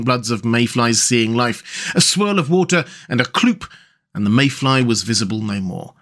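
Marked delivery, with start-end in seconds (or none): none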